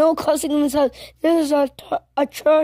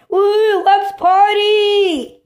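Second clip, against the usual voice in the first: loud, higher whoop, the party part drawn out slowly; excited whoop; 6 semitones higher, 5 dB louder, much slower than usual. Acted. Kid celebrating being allowed to cuss, loud drawn-out whoop. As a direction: cheer like you just won.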